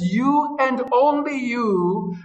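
slow, every word You and only you weighted; emphatic, slow. Acted. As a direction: spell out You and only you slowly. Preacher stresses Jesus' words.

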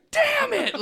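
very high, loud squealed outburst; hammed-up exasperated cry; mock frustration. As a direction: squeal it like a thwarted kid. Retelling his frustrated childhood outburst.

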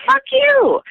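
laughing retort. Retold or imitated. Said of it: "would laugh and be like".

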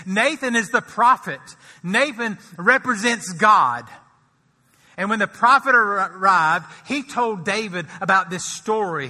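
loud, high, impassioned preaching; rising sermon fervour. Acted. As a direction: preach it out full force. Preaching at full force.

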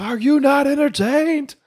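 high, slow, mock epic bellow; Gladiator shout. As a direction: roar it like a gladiator. Movie quote, Gladiator shout.

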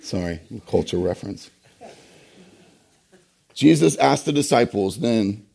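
normal talking voice

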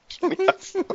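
high squealed mock despair; mock dramatic wail. Mock dramatic echo of friend.

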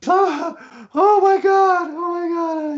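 very high, drawn-out, falling mimic wail; imitating helpless laughter. Michael getting up off the carpet, imitated laughing wail. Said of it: "he's like that".

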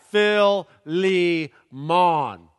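Phil, Lee, Mon said in Southern style, slow and loud; southern pronunciation. Shown as a demonstration. Pastor spelling out a Southern Philemon for the congregation, loud. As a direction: break it into three punny names, Southern. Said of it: "the southern pronunciation".

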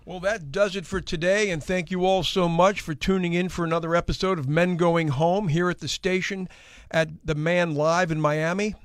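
upbeat radio host sign-off; show outro. Acted. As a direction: wrap up the episode like an announcer.

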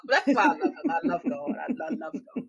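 high, laughing through the words; laughing praise. Real, not acted. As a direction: overflow with giddy, affectionate laughter. Overcome with laughter praising God.

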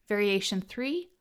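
usual speaking voice

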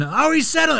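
high mock whine of oh he's settling; commentator complaint. Mimicking the Hornets caller a second time, high mock whine.

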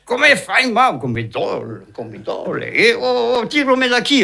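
high, pained howling; yelling in pain. Acted. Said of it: "à crier, à gueuler".

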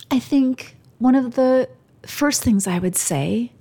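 choked up and pausing between phrases; emotional. Real, not acted. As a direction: restart the thought while fighting real emotion. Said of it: "I might get emotional".

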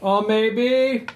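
high, drawn-out Jamaican lilt; Jamaican accent. Acted. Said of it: "you're hearing a Jamaican voice".

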